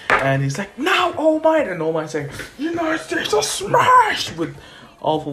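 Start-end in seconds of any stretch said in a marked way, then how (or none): 0.8-1.7 high-pitched mock battle yell; acted-out hero call; urgent cue. Acted call to partner mid-fight. 2.6-4.3 high, drawn-out mock battle yell; hammed-up superhero finishing move; attack cry.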